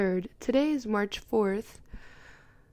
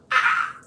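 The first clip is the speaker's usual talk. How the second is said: excited gasp; 7 dB louder than usual. Real, not acted. Reacting to the book.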